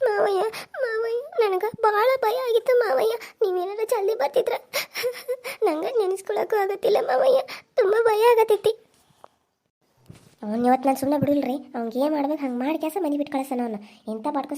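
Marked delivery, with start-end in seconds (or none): 0.1-8.7 slow, higher tearful sobbing plea; crying. Acted. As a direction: weep out how scared she was. Frightened niece weeps to uncle.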